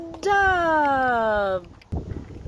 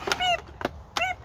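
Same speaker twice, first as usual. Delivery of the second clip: chick peep imitation; 5 dB quieter than usual. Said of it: "What does the chick say?".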